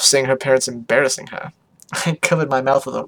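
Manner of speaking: usual speaking voice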